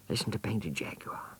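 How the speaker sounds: whispering urgently; hushed whisper. Acted. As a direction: hush your friend so you can overhear. Hiding, hushing friend.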